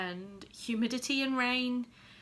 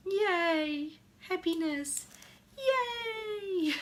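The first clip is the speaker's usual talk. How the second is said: squealing yay, higher and louder; high-pitched cheering; 10 semitones higher, 4 dB louder, much slower than usual. Real, not acted. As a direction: cheer like a giddy fan. Mock cheering for doll couple.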